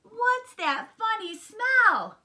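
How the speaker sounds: very high, loud, slow voice; disgusted girl frog. A narrator's reading. Frogalina sniffing out the aftershave, high loud squeal. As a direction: squeal the question slowly.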